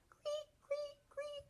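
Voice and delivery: very high, soft cleek; cricket chirp. Shown as a demonstration. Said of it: "The crickets go".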